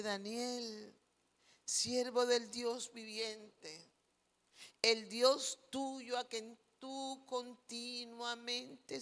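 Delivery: hushed, slow, sorrowful plea; sad voice. Acted. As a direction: plead softly as a despairing king hoping for a miracle. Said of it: "con voz triste y le dice". (voz triste y baja, suplicando despacio)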